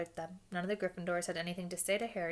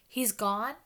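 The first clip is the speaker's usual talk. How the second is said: louder, higher startled question; frantic; 4 semitones higher, 7 dB louder than usual. A narrator's reading. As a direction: blurt it out in alarm. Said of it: "said Harry frantically".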